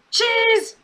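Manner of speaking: very high mock yelp; high-pitched imitated outburst; exclaimed echo. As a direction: blurt it out high, hammed up. Otis's outraged echo of Tucker.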